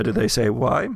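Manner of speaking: usual speaking voice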